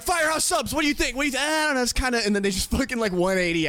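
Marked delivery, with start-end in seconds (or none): 0.0-2.2 mock firefighter voice. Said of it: "They're like".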